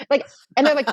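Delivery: usual speaking voice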